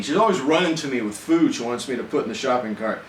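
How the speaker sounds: ordinary delivery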